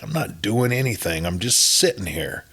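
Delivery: usual speaking voice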